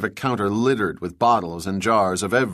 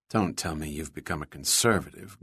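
playful Valley Girl-free mock dismay, softer; mock horror; 6 semitones lower, 8 dB quieter than usual. A narrator's reading. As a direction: pretend to be appalled, teasing. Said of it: "Gwen said in mock horror".

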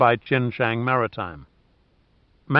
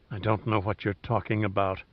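quiet, scornful mutter; contemptuous mutter; 4 semitones lower, 9 dB quieter than usual. A narrator's reading. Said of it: "she muttered contemptuously".

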